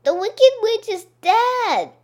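loud, high cry of triumph; joyful shout. Acted. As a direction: shout the good news. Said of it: "she shouts".

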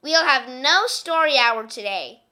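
loud, stern classroom order; a strict teacher barking no story hour. Acted. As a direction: lay down the law to the class. Strict teacher Miss Swamp orders.

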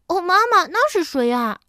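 lightly voiced cub, high loud start; a touch of a giggly child; giggly little bear. A narrator's reading. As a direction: ask Mama with childish delight. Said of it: "小熊咯咯地笑着问".

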